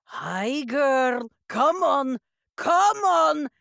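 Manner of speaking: high, loud animal call, building; calling to bear. Acted. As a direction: call out to coax a big animal. Character calls out to the bear.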